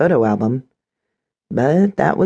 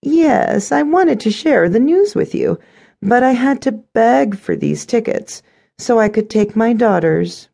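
high-pitched, pointed stepmother voice; self-satisfied stepmother; 10 semitones higher than usual. A narrator's reading. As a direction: play the stepmother excluding her, pitched high.

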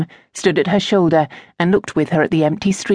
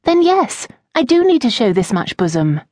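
tart; 7 semitones higher than usual. Acted. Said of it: "with some asperity".

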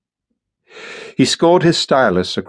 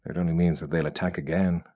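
soft, quick and despondent; 2 semitones lower, 9 dB quieter, faster than usual. A narrator's reading. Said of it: "said Kurtz despondently".

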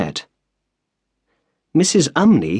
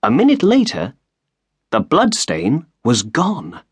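ordinary delivery

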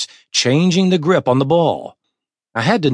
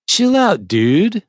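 laid-back surfer; 7 semitones higher, slower than usual. Acted. Surfer pitcher calms the catcher.

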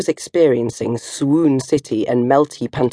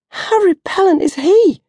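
disgusted. Acted. Disgusted at Mark.

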